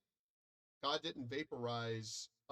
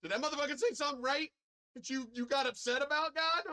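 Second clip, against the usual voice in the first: much higher and louder, rushed, near-crying disbelief; mock outraged crowd; 9 semitones higher, 5 dB louder than usual. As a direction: squeal it out like a shocked onlooker. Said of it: "I see it on both sides, good and evil, going".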